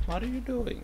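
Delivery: very high-pitched mock protest; squeaky retold outcry; mimicked mother. Mimicking mother's reaction again.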